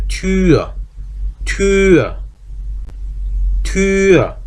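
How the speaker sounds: "튜어" with lips held rounded, no glide, pitched high; Tür with ü, lips kept rounded; German Tür. Shown as a demonstration. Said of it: "그러니까 이대로 발음하면".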